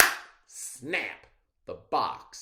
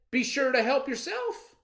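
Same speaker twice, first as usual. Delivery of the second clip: higher, louder quoted daddy; mock fatherly permission; 9 dB louder than usual. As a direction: put on the daddy's voice inside the lie. Rabbit quoting daddy.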